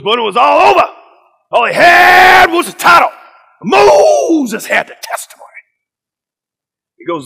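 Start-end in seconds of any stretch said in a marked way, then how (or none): 0.0-5.5 Pastor Kevin Cosby. Retold or imitated. Pastor sweeping through the Bible for students, shouted preacher imitation. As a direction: shout in rhythmic bursts, names drawn out.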